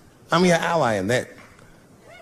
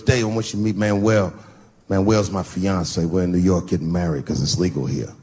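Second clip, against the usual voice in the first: friend voiced lower than his own; deep friend's voice; 8 semitones lower than usual. Introducing his fiance to Dave, in a lower voice. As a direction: drop low to play the friend. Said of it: "He goes".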